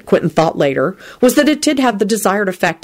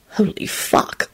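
startled whisper, much softer; 6 dB quieter, much slower than usual. A narrator's reading. Lupin to Elizabeth, startled whisper. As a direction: whisper it in shock. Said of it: "Lupin whispered to Elizabeth".

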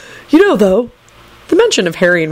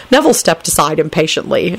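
usual speaking voice

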